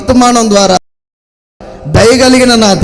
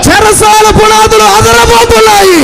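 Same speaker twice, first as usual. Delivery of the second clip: preaching at full force; 7 semitones higher than usual. Acted. Pastor proclaiming to the congregation, building to a shout.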